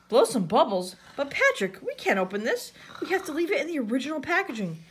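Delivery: mildly shocked protest, pitch jumping; SpongeBob. A narrator's reading. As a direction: object in alarm, hurrying at the end. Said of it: "SpongeBob looked shocked".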